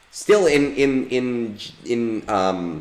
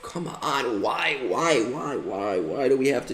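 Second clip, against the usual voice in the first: higher, whiny real frustration; exasperated whine; 5 semitones higher, 3 dB quieter than usual. Real, not acted. Player whining at the game as the battle goes wrong. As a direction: complain to the game, pleading why.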